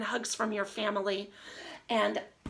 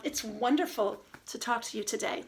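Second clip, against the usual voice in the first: ordinary talk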